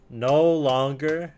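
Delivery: slow, loud, high hype proclamation; YouTuber voice. Pretend. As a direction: announce it like a YouTube host. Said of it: "in, like, a YouTuber voice".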